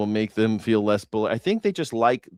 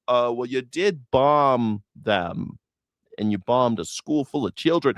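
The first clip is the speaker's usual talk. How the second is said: usual speaking voice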